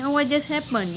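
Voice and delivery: "what just" said as "wha jus", both t sounds dropped; silent t in what and just. Shown as a demonstration. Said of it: "आप भी मेरे साथ practice करें".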